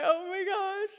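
put-on panicked child. Girl panics after the owner's threat, high and crying. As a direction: panic like a caught kid.

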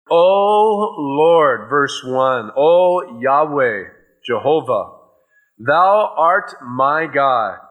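preacher intoning the psalm slowly; scripture reading. Acted. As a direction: read it out slowly like holy writ, each word weighed. Slow scripture reading of Isaiah.

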